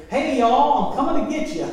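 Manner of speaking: put-on joking Southern hillbilly accent for a killer robot. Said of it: "a robot spoke like a hillbilly".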